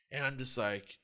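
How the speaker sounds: ordinary talk, nothing marked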